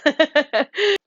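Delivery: sheepish giggle after the apology; embarrassed laugh. Real, not acted. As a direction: laugh it off at yourself. Laughs at own accent.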